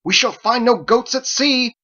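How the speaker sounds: loud, high, slow shout of frustration. Acted. Andolin shouts at the protesting crew, loud and slow. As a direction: throw arms out and shout it. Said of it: "and shouted".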